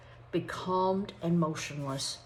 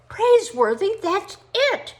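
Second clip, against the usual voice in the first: much higher and louder burst of discovery; excited exclaim; 13 semitones higher, 10 dB louder than usual. Acted. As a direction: shout as the idea strikes. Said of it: "he exclaimed".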